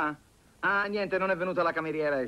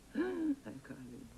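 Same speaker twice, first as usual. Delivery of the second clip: dread murmur; 14 semitones higher, 9 dB quieter, slower than usual. Acted. Dreaded aunt arrives.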